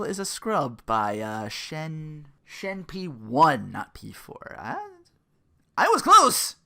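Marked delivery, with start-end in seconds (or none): 4.6-6.6 high, loud yelp of pride; sudden thrilled cry of I was close; excited outburst. Real, not acted. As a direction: shout it like you nearly got it right. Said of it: "correcting himself".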